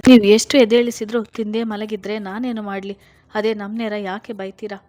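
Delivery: loud, indignant retort; wife snaps back at her husband; hitting back. A narrator's reading. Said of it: "ಆಕೆಯೂ ತಿರುಗಿ ಬಿದ್ದಳು".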